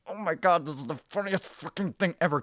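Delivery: strained, squeezed voice holding in a laugh; choking back laughter. Retold or imitated. Worker trying not to laugh at supervisor, mock choked-up voice. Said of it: "choking, making sure I'm not laughing".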